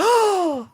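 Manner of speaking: high, theatrical gasp; excited gasp. Acted. Sphinx hearing the right answer, high excited gasp. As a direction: gasp in delighted surprise.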